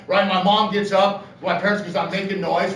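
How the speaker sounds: ordinary delivery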